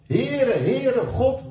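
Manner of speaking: loud and high, calling the name out; proclaiming. Acted. God proclaims his own name. (luid en hoog uitgeroepen)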